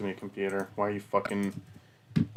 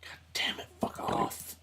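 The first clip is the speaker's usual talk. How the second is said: whisper; 4 dB quieter than usual. Real, not acted. Muttering at his own mistake.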